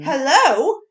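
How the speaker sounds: startled protest with a big jump in volume; indignant surprise. Real, not acted. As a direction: call it out sharply, as if just kicked. Reacts to being kicked mid-recap.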